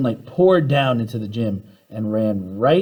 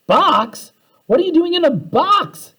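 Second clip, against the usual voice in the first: high, louder yell of disbelief; surprised yell; 5 dB louder than usual. A narrator's reading. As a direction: shout it in shocked surprise. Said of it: "yelled Keisha".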